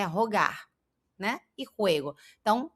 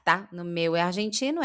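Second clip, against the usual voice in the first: usual speaking voice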